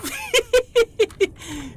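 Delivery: shrill laugh under pressure; high nervous laugh. Real, not acted. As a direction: burst out laughing high and tense. Fighting the monster.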